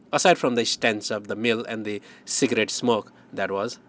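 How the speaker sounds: plain reading voice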